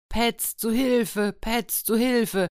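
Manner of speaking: high, slow desperate cry for help. A narrator's reading. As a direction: call the bear in panic, drawn out. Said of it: "schrie der Alte". (hoher, langsamer Hilferuf in Panik)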